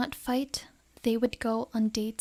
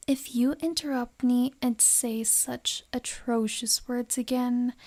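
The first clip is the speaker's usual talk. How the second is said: usual speaking voice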